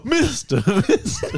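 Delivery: high laughing "Mister, Mister."; mock voice. Improv bit imitated, high laughing squeak. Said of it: "I go".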